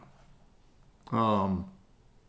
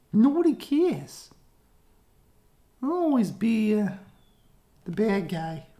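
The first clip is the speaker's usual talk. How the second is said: much higher, louder self-pitying whine; whiny high villain; 13 semitones higher, 5 dB louder than usual. Acted. Villain complaining to kids that nobody cares, high whine. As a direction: whine like a misunderstood bad guy.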